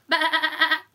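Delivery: loud bleat of a goat; loud mock baa.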